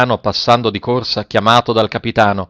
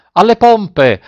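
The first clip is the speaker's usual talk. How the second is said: a captain's booming order, much higher and louder; thundering shout; 10 semitones higher, 6 dB louder, slower than usual. A narrator's reading. Captain ordering sailors to the pumps, thundering shout. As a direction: roar the command over the storm. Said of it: "Una voce tonante gridò".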